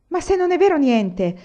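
put-on young man bursting with joy; joyful outburst. A narrator's reading. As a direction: blurt it out overjoyed. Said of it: "proruppe Anania pieno di gioia".

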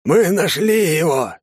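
slow, high excited shout. A narrator's reading. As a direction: yell out the discovery. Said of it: "в голос закричал".

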